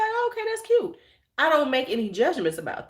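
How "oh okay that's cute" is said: much higher, sugary dismissive praise; mock polite. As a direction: chirp it sweetly, a little condescending. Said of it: "I look at her stuff and be like".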